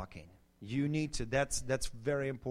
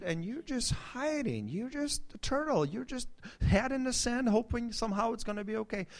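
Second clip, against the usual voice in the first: imitated counselor; 7 semitones higher than usual. Counselor urging the timid wife to speak up, high imitation. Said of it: "she said".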